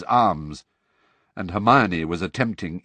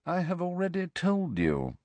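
smoothly; 9 semitones higher, 5 dB quieter than usual. A narrator's reading. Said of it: "said Snape smoothly".

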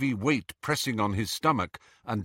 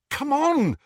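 high, loud urging; impatient; 5 dB louder than usual. A narrator's reading. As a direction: hurry him along sharply. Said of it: "she said impatiently".

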